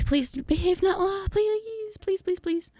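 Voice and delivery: high, anxious begging; nervous pleading. Real, not acted. Begging game enemy to behave.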